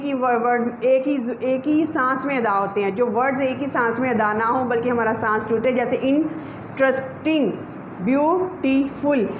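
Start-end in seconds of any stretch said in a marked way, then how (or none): 6.0-9.3 slow, each syllable set apart; teacher slowly counting out syllables. Shown as a demonstration. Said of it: "हमने तीन दफ़ा अदा किए".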